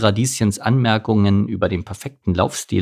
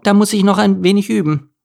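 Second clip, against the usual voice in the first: put-on high, girlish character voice; cheerful resolve to train; high light character voice; Steffi voice; 11 semitones higher, 4 dB louder than usual.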